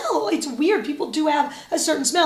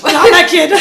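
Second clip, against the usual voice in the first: laughing mock verdict; 2 semitones higher, 9 dB louder than usual. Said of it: "And you'll go".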